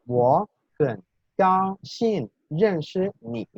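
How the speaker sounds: "Wo hen gao xin ren shi ni" slowly, word by word with exaggerated tones; tone demonstration. Shown as a demonstration. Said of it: "los tonos". ("Wo hen gao xin ren shi ni" lento, marcando cada tono)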